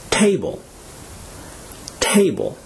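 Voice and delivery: table, first syllable high, then falling; stress on first syllable. Shown as a demonstration. Teacher models table for learners, stressing the first syllable. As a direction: hit the "ta" in table, let the rest drop. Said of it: "stressed on the first syllable".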